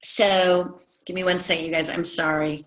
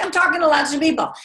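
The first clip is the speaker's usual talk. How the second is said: imitating ambassador; 9 semitones higher, 4 dB louder, faster than usual. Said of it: "And they would say".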